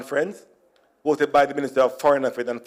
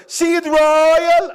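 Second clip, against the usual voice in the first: calling out; 12 semitones higher, 4 dB louder than usual. Acted. Calls out in mock amazement.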